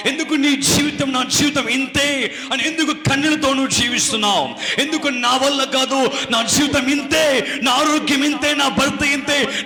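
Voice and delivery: high, loud prophetic exhortation; full-force preaching. Real, not acted. Preacher asking the congregation why they weep, impassioned. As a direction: cry out each question with rising urgency.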